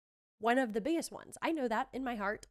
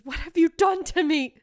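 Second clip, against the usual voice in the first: loud, higher anguished cry; Tin Man line; 6 semitones higher, 12 dB louder than usual. Retold or imitated. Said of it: "And he says".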